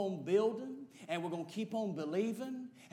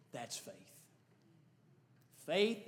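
ordinary talk

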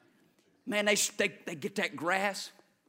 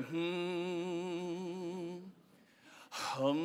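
mock meditation hum; 7 dB quieter than usual. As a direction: hum like dozing in a recliner. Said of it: "sit back in my lazy boy and go".

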